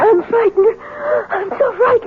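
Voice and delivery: high, trembling confession of fear; clinging to him, terrified; frightened. Acted. Terrified wife seeks comfort.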